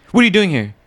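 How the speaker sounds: hammed-up teacher throwing a student out of class; mock angry teacher. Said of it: "He'd always be like".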